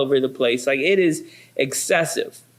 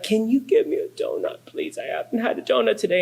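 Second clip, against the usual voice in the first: put-on higher, sweet pleading child; begging little girl; 5 semitones higher, faster than usual. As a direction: plead like a puppy-eyed five-year-old.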